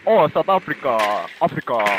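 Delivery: slow, put-on South African accent, dropping low at the end; drawn-out parody of a South African accent; mock South African accent. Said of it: "Just 'cause I don't talk like".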